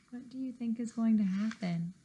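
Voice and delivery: ordinary delivery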